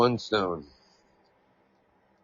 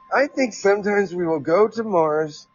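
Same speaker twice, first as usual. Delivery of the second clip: article read-aloud; 4 semitones higher than usual. Acted. Host reads a quote about Mars to listeners, raised reading voice.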